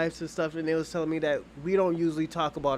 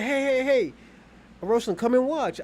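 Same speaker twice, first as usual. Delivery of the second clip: calling out; 8 semitones higher than usual. Retold or imitated. The husband calling the narrator back to watch, eager higher call.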